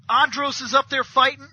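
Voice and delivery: normal talking voice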